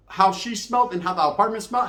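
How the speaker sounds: ordinary talk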